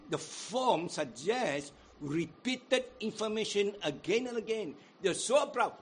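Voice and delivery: ordinary talk, nothing marked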